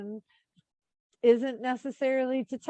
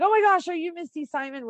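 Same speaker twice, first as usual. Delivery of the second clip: fast, high and eager; excited fan; 9 semitones higher, faster than usual. Retold or imitated. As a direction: gush like a fan spotting her idol. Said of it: "they're like".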